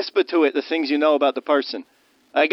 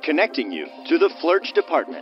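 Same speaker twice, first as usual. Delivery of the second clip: phone menu voice; slower than usual. Acted.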